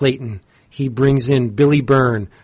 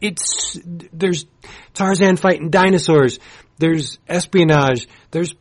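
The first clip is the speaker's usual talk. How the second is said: louder, higher, extremely excited rave; real excitement; 4 semitones higher, 4 dB louder than usual. Real, not acted. Comics fan raving to listeners about a new series, extremely excited. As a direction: gush like a thrilled fan. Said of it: "how extremely excited I am".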